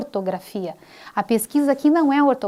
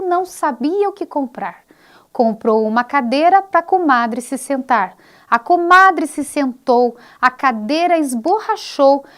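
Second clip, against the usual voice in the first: nursery rhyme recital; 2 semitones higher, 4 dB louder than usual. Acted. Teacher reciting a children's parlenda to viewers, in singsong. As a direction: recite it like for little kids.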